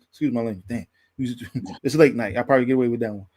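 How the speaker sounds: normal talking voice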